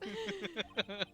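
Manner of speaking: high-pitched surprised squeal; Zim surprise noise. Pretend. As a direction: yelp of cartoon surprise. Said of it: "I'm gonna do the Zim surprise noise".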